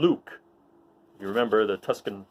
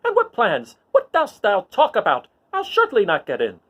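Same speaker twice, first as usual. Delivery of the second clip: high, loud, prissy droid scolding; high-pitched indignant protocol droid arguing; fussy C-3PO; 11 semitones higher, 7 dB louder than usual. Acted. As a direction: scold the little droid like a huffy butler. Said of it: "he's arguing with C three P O".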